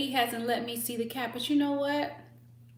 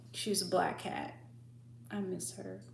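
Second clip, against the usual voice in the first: ordinary talk, nothing marked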